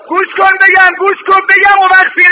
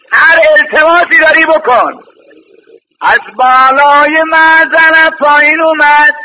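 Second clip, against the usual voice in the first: ordinary delivery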